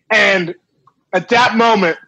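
ordinary delivery

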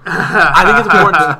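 loud, laughing restart. Real, not acted. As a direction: push through the giggles. Teased mid-sentence, breaks into laughter.

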